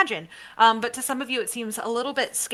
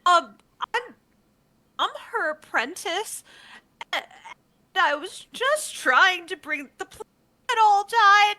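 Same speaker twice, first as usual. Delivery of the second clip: flustered gnome apprentice; 9 semitones higher than usual. Acted. Gnome apprentice confessing to the party, high and tearful. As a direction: stammer then blurt the sorry like a guilty little gnome. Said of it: "and she goes".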